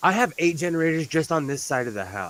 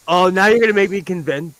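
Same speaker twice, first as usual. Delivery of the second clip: usual speaking voice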